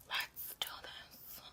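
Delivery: slow, hushed whisper. Real, not acted. Getting viewers started on the meditation, whispered. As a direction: whisper it slowly, barely any voice.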